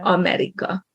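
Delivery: America in Hungarian, all vowels equally clear; every syllable clear. Shown as a demonstration. Teacher demonstrates clear unstressed vowels, every syllable clear. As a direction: sound out each vowel of America, stress first. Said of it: "equally clearly, whether it's stressed".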